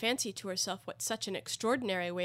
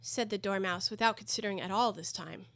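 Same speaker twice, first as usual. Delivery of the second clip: usual speaking voice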